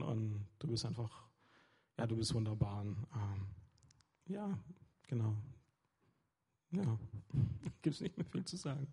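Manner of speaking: soft, intimate prayer to God; quiet prayer. Acted. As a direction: speak to God gently, almost to yourself. Prays to God. (leises, inniges Gebet zu Gott)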